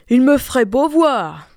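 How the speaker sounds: rude retort. A narrator's reading. Rude daughter refusing her mother, scornful. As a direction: spit back a refusal. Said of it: "répondit la brutale".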